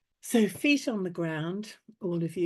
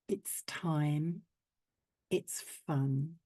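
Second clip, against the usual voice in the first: slow affirmation; 3 semitones lower, slower than usual. Acted. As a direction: soften and slow each line.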